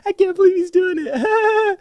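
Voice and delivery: very high, slow, squealing voice; imitating the It Follows smiling lady; creepy smiling lady. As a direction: mimic a horror-movie grin voice. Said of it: "And that's what she was doing".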